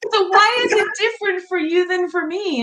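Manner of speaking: put-on high, amused teenage protest; teen protesting, laughing. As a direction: squeak it out, half laughing. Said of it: "I'm like".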